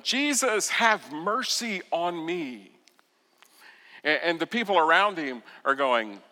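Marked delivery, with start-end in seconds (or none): 0.0-2.7 high, loud call of "Jesus", then a slow plea; blind beggar's cry. Acted. Blind beggar calling to Jesus over the crowd, loud and high. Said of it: "Bartimaeus yells out to him".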